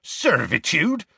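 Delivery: high, drawn-out scathing question. A narrator's reading. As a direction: throw the word back in disbelief. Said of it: "said Hagrid scathingly".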